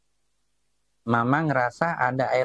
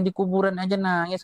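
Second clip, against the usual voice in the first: higher-pitched imitation of a complaining child; protesting child; 7 semitones higher than usual. Child scolds crying dad at the grave, imitated protesting child. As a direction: imitate a kid's indignant protest. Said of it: "anak saya protes tuh".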